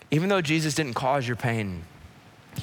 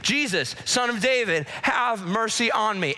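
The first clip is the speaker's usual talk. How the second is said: high, urgent plea to Jesus; impassioned high-pitched appeal; calling out in appeal; 9 semitones higher than usual. Acted. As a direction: cry out for mercy, stretching the last phrase. Acts out man calling to Jesus.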